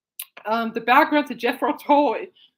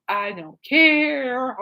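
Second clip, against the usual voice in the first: very slow, stretched mock apathy; imitating a long, showy shrug of a line; 4 dB louder, much slower than usual. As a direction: drag out the last word sarcastically. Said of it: "hearing [name]'s radio talk show".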